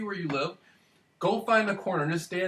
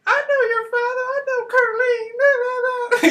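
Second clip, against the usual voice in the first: very high, louder put-on falsetto of the woman; imitating the woman; 7 dB louder than usual. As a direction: shriek it in a hammed-up falsetto. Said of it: "the woman's like".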